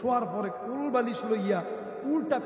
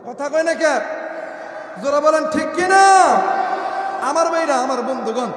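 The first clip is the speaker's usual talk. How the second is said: loud, raised sermon harangue; fiery preaching; 4 semitones higher, 7 dB louder than usual. Real, not acted. Preacher scolds sons who obey their wives, fiery loud preaching. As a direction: berate them at full force from the pulpit. Berates henpecked sons from pulpit.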